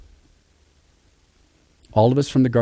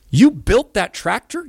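high, slow amazed question; 11 semitones higher, 4 dB louder, slower than usual. Retold or imitated. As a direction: marvel at it slowly. Amazed question about tractor.

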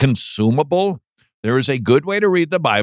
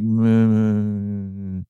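bored drone; 3 semitones lower, much slower than usual. Retold or imitated. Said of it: "it's gonna be like".

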